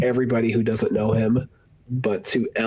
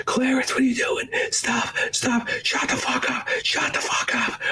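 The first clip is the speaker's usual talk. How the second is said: high, hushed frantic voice; mock panicked pleading; 14 semitones higher than usual. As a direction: plead in a frantic half-whisper. Everyone panicking at Clarence.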